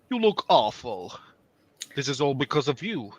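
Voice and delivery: a hint of a scolding old grandmother, taking her time; disapproving grandma. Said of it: "that gave disapproving grandma in my voice".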